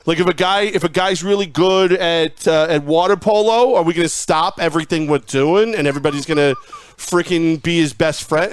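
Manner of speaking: high, hammed-up fake caller ranting; angry caller voice. Host calling his own show as a fake fan bashing the star, in a high put-on voice. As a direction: rant like an outraged sports radio caller. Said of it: "the impression wasn't an impression".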